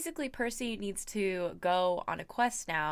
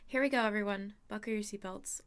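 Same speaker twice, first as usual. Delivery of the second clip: plain reading voice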